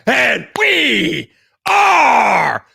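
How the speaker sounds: shouted, high hype-man opener, drawn out and roaring; hype show intro. Acted. Host opening his Friday night live stream, shouting hype-man style. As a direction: pump up the crowd like an arena announcer.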